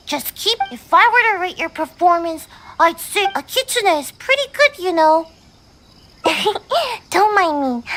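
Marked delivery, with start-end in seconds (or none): 6.2-7.0 short giggle. Real, not acted. [name] laughs after her score.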